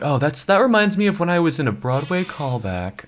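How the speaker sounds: high-pitched, showy humblebrag; mock bragging. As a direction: play up the name-dropping brag. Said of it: "I will brag about that forever".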